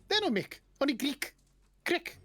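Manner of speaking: very high kobold voice; squeaked-up creature correcting a name; high, broken-English kobold; kobold barkeep. Acted. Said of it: "the kobold goes".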